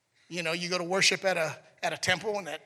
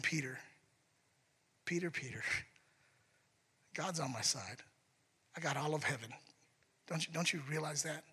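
patient gentle; 4 semitones lower, 12 dB quieter than usual. Retold or imitated. Jesus voiced patiently to Peter.

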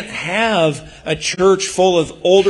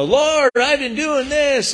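whiny complaint; 11 semitones higher, slower than usual. Retold or imitated. Voicing entitled believer to God.

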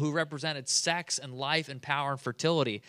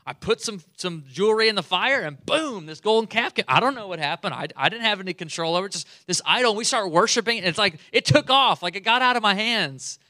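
higher, louder innocent excuses; childish Aaron; 5 semitones higher, 6 dB louder than usual. Retold or imitated. Aaron excusing himself to Moses, childish babble. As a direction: babble like a guilty kid. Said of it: "is what Aaron's saying".

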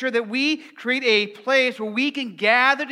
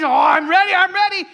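excited puppy; 9 semitones higher, 7 dB louder than usual. Retold or imitated.

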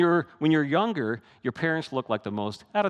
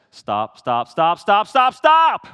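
from firm to loud high yell; escalating stop; 6 semitones higher, 11 dB louder, much slower than usual. Retold or imitated. Parent panicking at teen driver, building yell.

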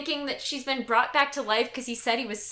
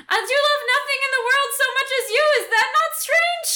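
very high, loud, breakneck outburst; mock blurted confession; 11 semitones higher, 5 dB louder, much faster than usual. Suitor suddenly confessing love after a pause, comic blurting. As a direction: blurt it all out in a rush.

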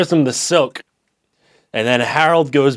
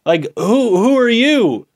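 high-pitched, acted shock; rising disbelief, pitched well up; startled mock alarm; 9 semitones higher than usual. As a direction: play the man startled by a ghost. Man acts startled at seeing ghost.

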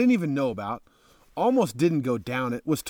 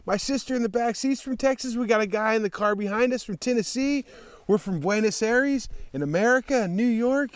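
imitating excited strangers; amazed strangers; 9 semitones higher than usual. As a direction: play the thrilled travelers. Strangers amazed at Texas retold.

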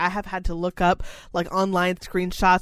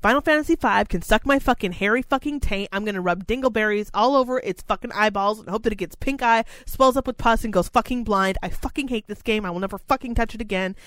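angry rant at the game; ranting fury. Retold or imitated. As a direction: explode then keep venting. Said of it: "And I was like".

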